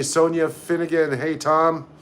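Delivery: normal talking voice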